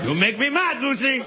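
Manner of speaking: hammed-up Cuban complaint. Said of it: "her husband [name]".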